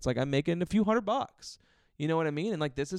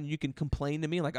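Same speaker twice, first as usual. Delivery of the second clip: usual speaking voice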